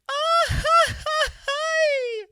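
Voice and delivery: very high, whooping mariachi yell; mariachi grito. Retold or imitated. Podcaster imitates a mariachi song's grito, high and whooping. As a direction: let out a shrill festive grito like a mariachi singer. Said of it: "How do they do it in the mariachi songs".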